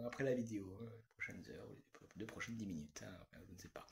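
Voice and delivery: very soft, low, near whisper; muttered aside. Real, not acted. Vlogger correcting their own plans for after the video, near-whispered aside. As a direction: drop to an almost voiceless mumble.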